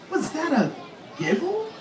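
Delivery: soft, high inner question; wondering thought. A narrator's reading. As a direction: wonder it softly to yourself. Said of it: "Henry thought".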